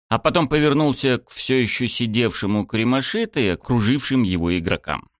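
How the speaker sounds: plain reading voice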